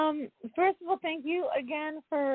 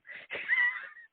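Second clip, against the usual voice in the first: shrill burst of laughter; high squealing laugh. Real, not acted. Laughing at the garbled audio.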